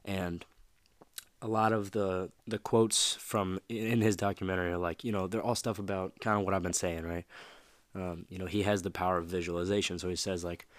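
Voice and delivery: usual speaking voice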